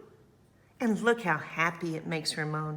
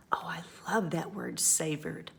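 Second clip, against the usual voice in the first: whisper; 2 semitones lower than usual. Real, not acted. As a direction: share it like a secret. Aside to children, whispered.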